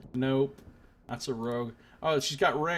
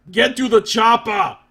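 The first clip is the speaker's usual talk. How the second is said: loud, higher action-hero shout; Arnold Schwarzenegger; 6 semitones higher, 10 dB louder than usual. Pretend. Gamer shouts an Arnold line to test the voice. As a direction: bellow it like a movie commando. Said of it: "Voice like Arnold Schwarzenegger".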